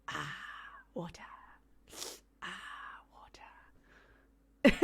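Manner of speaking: unvoiced whispered ah, water; mother mimics her daughter in the bath, whispering; imitating toddler's whisper. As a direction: whisper it softly, like a toddler copying a cartoon. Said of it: "She was sitting there going".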